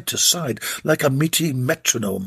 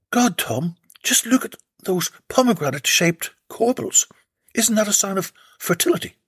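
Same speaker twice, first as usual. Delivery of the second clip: excited; 7 semitones higher than usual. Acted. Slavia gushing over corbels.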